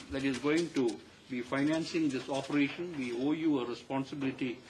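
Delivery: ordinary delivery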